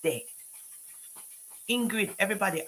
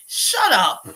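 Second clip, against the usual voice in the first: sharp shout; 5 semitones higher, 8 dB louder than usual. Real, not acted. Telling off a viewer.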